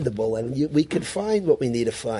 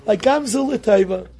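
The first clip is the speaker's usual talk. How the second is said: lightly tossed-off gamzu l'taiva, a bit higher; mocking casual use; 5 semitones higher, 3 dB louder than usual. Mimicking how people glibly say gamzu l'taiva, breezy. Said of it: "People use the word gamzu l'taiva in ways".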